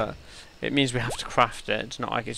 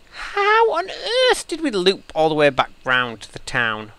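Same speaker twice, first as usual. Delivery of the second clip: incredulous; 7 semitones higher, 8 dB louder than usual. Real, not acted. Baffled at looping back.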